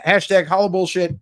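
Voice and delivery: usual speaking voice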